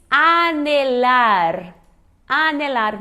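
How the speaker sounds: slow "anelar" with a plain N; silent H. Shown as a demonstration. Teacher models the Spanish verb, slow "anelar" with plain N. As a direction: draw out "anelar", no nh sound. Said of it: "o H não tem som em espanhol".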